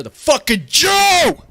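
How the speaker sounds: loud, high angry shout; real angry outburst, pitch shooting up; pissed-off yell. Real, not acted. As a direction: explode into a furious yell. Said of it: "I'm fucking pissed".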